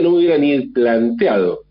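usual speaking voice

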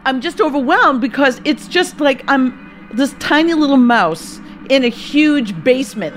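ordinary talk, nothing marked

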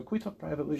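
very soft, discreet little request; hushed, confidential tone. Acted. Student approaching a teacher privately, hushed and confidential. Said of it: "it has that tone, that cadence".

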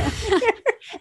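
high, loud burst of laughter; real laugh. Real, not acted. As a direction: laugh out loud, caught off guard. Laughing at co-host's remark.

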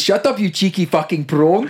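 playful South African impression; South African accent. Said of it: "You do South African".

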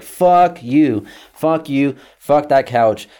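emphatic mock tirade; defiant cursing. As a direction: curse him out in an acted rant. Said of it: "I'm cursing at him".

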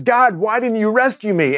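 high-pitched, hammed-up protest; mock complaint. Man in heaven questions God, mock complaint. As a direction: act out a plaintive question, pitched well up.

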